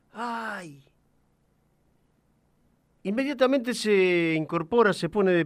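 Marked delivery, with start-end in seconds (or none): 0.1-0.8 distant echo. A narrator's reading. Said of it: "una voz repitiendo en algún lugar".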